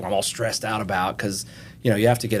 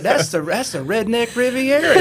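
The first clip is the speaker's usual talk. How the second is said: drawn-out Southern Mississippi drawl, pitched higher; 13 semitones higher, 4 dB louder than usual. Retold or imitated. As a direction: imitate a Mississippi classmate drawling his words. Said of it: "he had that Mississippi drawl".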